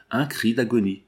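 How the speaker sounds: ordinary delivery, nothing marked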